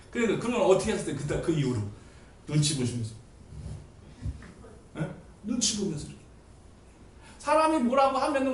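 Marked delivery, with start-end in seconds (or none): none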